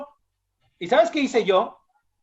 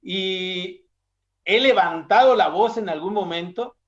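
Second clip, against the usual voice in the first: ordinary talk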